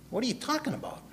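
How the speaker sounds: a retold what-are-you-talking-about; baffled retort. His retold baffled reply.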